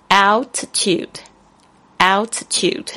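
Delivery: altitude said slowly, syllable by syllable; slow, careful altitude for learners; slow and clear. Shown as a demonstration. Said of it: "这个高度是这样说的".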